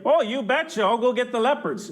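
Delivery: light mock Canadian accent; a cheerful hint of a Canadian accent. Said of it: "was that your Canadian accent?".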